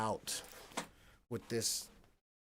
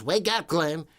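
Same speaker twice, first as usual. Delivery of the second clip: imitating Janice; 4 semitones higher, 10 dB louder than usual. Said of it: "in that voice".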